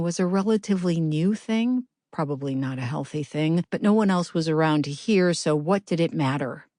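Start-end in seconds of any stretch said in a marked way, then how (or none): none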